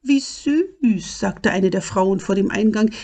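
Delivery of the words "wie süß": high-pitched gushing; a higher, smitten woman's voice; cooing delight. A narrator's reading. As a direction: coo over a cute little animal. Woman coos at the little dragon.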